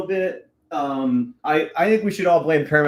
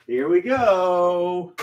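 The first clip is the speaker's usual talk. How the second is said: slow dramatic; 4 semitones higher, much slower than usual. Acted. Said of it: "slow dramatic launch".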